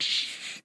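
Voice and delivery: soft, voiceless shush; imitated shushing sound; hushing shh. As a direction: hush them with a quiet "shh". Imitates hushing in his story.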